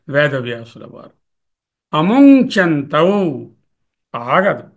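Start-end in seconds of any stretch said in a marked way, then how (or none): 1.9-3.4 slow recited word. Shown as a demonstration. Teacher repeats the quoted word.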